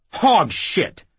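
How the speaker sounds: loud, high burst of disbelief; angry yell. Acted. Jackal rejecting the whoremaster's account, angry yell. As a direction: yell it as a flat-out denial. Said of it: "Jackal yelled".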